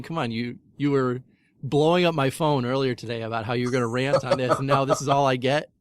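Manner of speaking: ordinary talk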